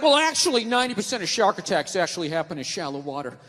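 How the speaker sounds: low pedantic friend voice; mock know-it-all. Diving friend correcting him with stats, low and pedantic. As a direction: recite the fact, dropping well down in pitch.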